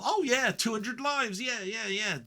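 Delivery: high, slow mocking sing-song; high sarcastic mimic of a smug viewer. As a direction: ham up the casual offer.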